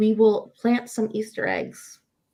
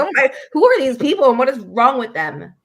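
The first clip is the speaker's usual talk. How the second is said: higher and louder, mock-appalled outburst; aghast onlooker; 4 semitones higher, 7 dB louder than usual. Onlooker gawking at two friends reuniting in the airport, mock aghast. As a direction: voice a stranger's loud disbelief. Imagining onlookers' shocked reaction.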